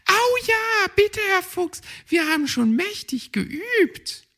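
put-on very high, eager children's chorus; children begging Herr Fuchs. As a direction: plead like little forest kids, high and loud.

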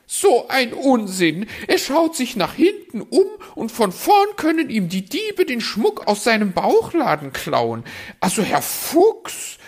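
very high, loud, shrieking scold; the magpie tells the fox off; furious magpie scolding the fox, high and loud. Acted. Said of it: "schimpfte die Elster".

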